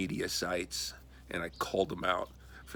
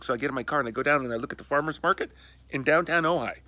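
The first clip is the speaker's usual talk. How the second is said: ordinary talk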